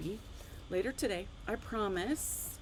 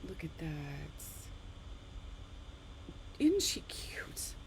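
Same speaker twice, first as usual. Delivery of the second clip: cooing whisper; 6 semitones lower, 7 dB quieter, slower than usual. Real, not acted. Admiring her own dog card, whispered slow and adoring. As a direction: melt over the cute dog in a whisper.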